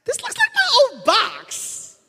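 very high, loud, slow mock wife voice; imitating wife. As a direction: drawl it out as his amused wife. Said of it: "she's like".

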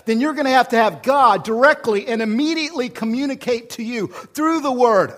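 loud, high, building preacher's cry; full-force preaching. Acted. Pastor urging the congregation to hear God, preaching loud. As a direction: drive it home from the pulpit.

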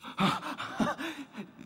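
high, breathy little laugh; short embarrassed chuckle; nervous laugh. Real, not acted. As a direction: laugh at his own fumbling. Laughs finding the verse.

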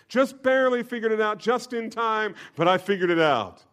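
high, loud, self-satisfied mock boast; smug boast. Proud believer in heaven boasting, mock smug. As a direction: play a proud fool congratulating himself. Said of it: "stand in heaven and say".

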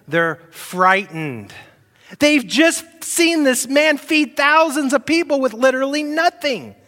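loud, high preaching build; rising sermon delivery; full-force preaching. Acted. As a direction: preach it out to the congregation.

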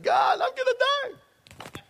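high, slow, drawn-out wail; despairing wail. Retold or imitated. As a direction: cry out to God like you're dying of hunger. Starving alone, crying out to God.

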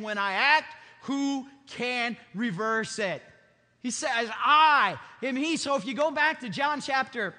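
loud, high-pitched sermon voice; preaching at full force. Acted. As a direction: preach it out to the whole congregation. Preaches from John and Isaiah.

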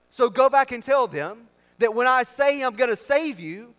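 high, loud preacher voicing God; God's commanding declaration. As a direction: proclaim it forcefully as God sending his messenger. Preacher voices God commanding Moses.

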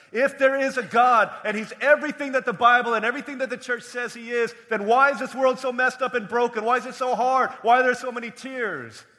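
loud, high challenge; urgent pulpit outcry; impassioned preaching. Real, not acted. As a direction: hurl the doubter's questions out to the room. Voices a doubter's challenge, preaching.

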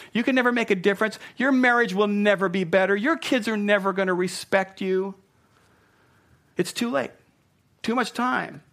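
high, loud taunting accuser; the accuser's voice. Acted. Preacher voicing Satan the accuser to the guilty: high mocking taunt. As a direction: sneer every charge up high and loud. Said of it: "some of you know that voice".

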